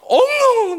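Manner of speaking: 길게 늘인 과장된 통곡 흉내; 큰 소리로 엉엉 우는 척. Retold or imitated. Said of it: "엉엉대고 우는 거야". (다윗의 울음을 보여 주며, 엉엉 우는 흉내)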